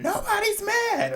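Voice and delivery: very high, slow mock scream. Emma yelling at Summer during the fight, mock high scream. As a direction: shriek it way up high, dragging it out. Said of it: "Emma starts screaming".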